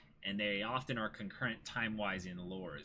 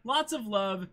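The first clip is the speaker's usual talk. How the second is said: excited thanks; 7 dB louder than usual. Real, not acted. Thanking donor for bits on stream.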